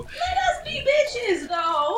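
high laughing squeal; laughing protest. Real, not acted.

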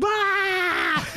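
high, loud mock shriek of glee; the friend reacts to the risotto order with a high shriek; delighted shriek. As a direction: shriek like he can't hold in the joke. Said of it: "He goes".

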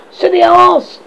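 very high, loud fairy shout; shouted insult. A narrator's reading. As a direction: shout it in a piercingly high little voice. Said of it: "Tinkerbell shouted".

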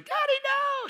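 very high imitated scream of a daughter; child's scream. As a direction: cry out like a desperate child. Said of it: "My daughter's screaming".

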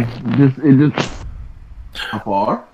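distant and muffled; far from mic. Real, not acted. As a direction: voice drifting away from the microphone. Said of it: "you sound really far".

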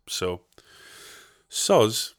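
usual speaking voice

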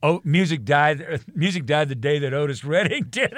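laughing declaration. Retold or imitated. Pest guy praising [name], loud and laughing. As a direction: laugh through the punchline as you quote him.

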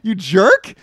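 high, loud, stretched-out disbelief; high outraged yelling. Real, not acted. Scolding a movie character to a friend, high and loud. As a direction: yell at the screen, appalled.

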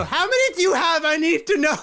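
high-pitched comic holler; mock-frantic high yell; yelling out the window. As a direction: demand an answer from people outside. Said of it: "Just yell at the window".

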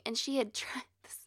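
whispered aside. Real, not acted. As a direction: drop into a secret whisper. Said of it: "whispered aside".

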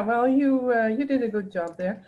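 usual speaking voice

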